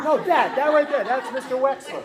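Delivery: high, loud imitation of an insistent student; excited pointing-out. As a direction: call it out, imitating an excited kid. Student pointing out disguised teacher to another.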